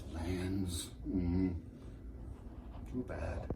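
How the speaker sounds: low, fading murmur; hushed regret. Acted. Lecturer regretting boarders' swollen glands, hushed regret. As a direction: let it sink away softly.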